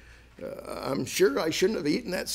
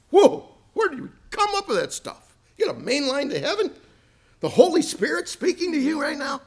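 much higher, faked wonder; mock amazement; 12 semitones higher, faster than usual. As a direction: gush in fake astonishment, high. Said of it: "it's like Job saying to them".

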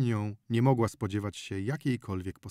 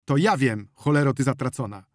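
loud, angry outburst; furious raised voice; sudden yell; 3 semitones higher, 7 dB louder than usual. A narrator's reading. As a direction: snap into a shouted curse. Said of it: "Wasil wrzasnął nagle".

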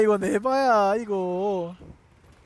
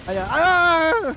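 drawn-out wail; 8 semitones higher, 8 dB louder, much slower than usual. Real, not acted. Reacting in game panic.

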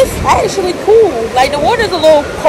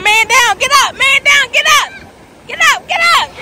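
rising higher and higher, urging someone up; excited calling out; 9 semitones higher than usual. Real, not acted. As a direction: cheer them back to their feet. Someone knocked over by wave.

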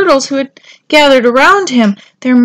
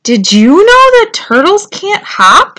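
high, loud excited shout; 8 semitones higher, 6 dB louder than usual. A narrator's reading. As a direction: yell it to the other turtles. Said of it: "shouted Tudley".

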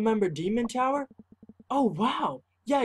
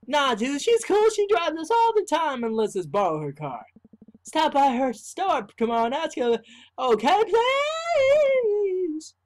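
pushy friend; 9 semitones higher, 5 dB louder than usual. Acted. Voicing pushy friend character.

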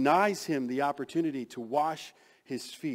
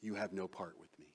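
quiet warning; 5 semitones lower, 7 dB quieter than usual. Acted. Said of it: "responds to his statement with a warning".

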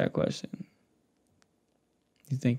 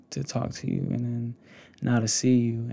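usual speaking voice

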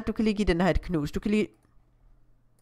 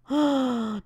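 higher, drawn-in breath of wonder; awed gasp; 5 semitones higher than usual. Real, not acted. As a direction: gasp at something pretty. Admires the game scene. (betaget gisp)

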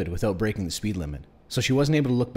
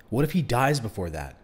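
usual speaking voice

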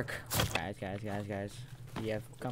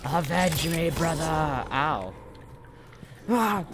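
overacted death scene; 6 semitones higher, slower than usual. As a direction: ham up a heroic death. Hammy dying hero lines.

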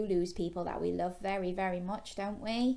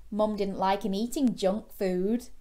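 ordinary delivery

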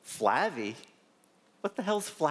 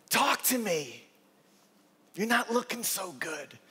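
a hint of the old patient's higher voice; lightly voiced Flavie; 4 semitones higher than usual. Patient urging her doctor to open up, lightly voiced. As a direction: gently press the doctor. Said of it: "And she goes on".

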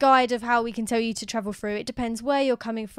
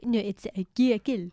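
put-on French accent. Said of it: "Oh, I just did a French accent".

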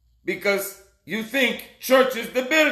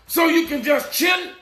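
full-force preaching; 4 semitones higher than usual. Acted. Preacher warning his flock about life and death, shouting. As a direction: thunder warnings at the congregation.

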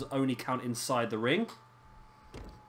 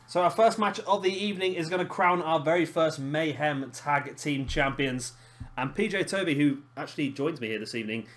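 show host intro; 3 semitones higher, 3 dB louder than usual. Acted. Host welcoming viewers to the wrestling show, hyped announcer voice.

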